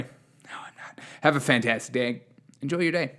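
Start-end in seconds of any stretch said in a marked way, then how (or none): 0.0-1.0 sly whispered aside. Pretend. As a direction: whisper it as a secret retraction. Whispered retraction of apology.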